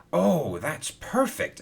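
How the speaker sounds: high, slow delighted cry; a lightly voiced delighted exclaim. A narrator's reading. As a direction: exclaim with pleased surprise. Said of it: "exclaimed Hero".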